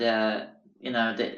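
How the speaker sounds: ordinary talk, nothing marked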